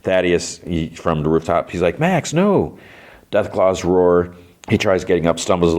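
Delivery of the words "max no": high-pitched imitated cry of alarm; half-voiced cry to a friend; mock calling out. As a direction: call out high, without real volume. Imitates man shouting from rooftop.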